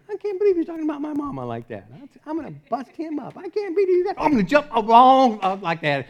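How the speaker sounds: high, squawky mock outrage; worked up over an insult, hammed-up; indignant inner voice. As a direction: fume in a high-pitched, rushed comic voice. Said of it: "you're just thinking".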